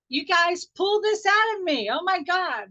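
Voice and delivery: high, loud burst of real delight; delighted surprise. Real, not acted. Teacher thrilled by the group, high and loud. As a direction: marvel at what the group drew out.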